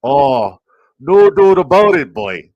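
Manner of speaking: high, loud Canadian exclaim; Canadian-accented hype shout. Pretend. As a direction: let the Canadian slip out big. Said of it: "Canadian coming out at the moment, eh?".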